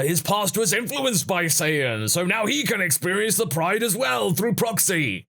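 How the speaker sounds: mock-heroic pronouncement; mock Saiyan boasting. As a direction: parody a proud warrior explaining. Continues the mock Vegeta answer.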